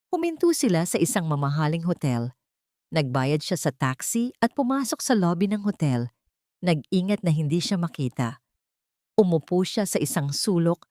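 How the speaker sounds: ordinary delivery, nothing marked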